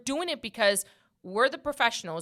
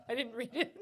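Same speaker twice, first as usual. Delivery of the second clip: ordinary talk, nothing marked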